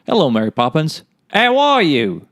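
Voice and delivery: inconsistent bad Cockney accent, leaping high and loud; wildly wavering mock-Cockney accent. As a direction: start mild, then lurch into broad fake Cockney. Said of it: "the accent wasn't good and it was also inconsistent".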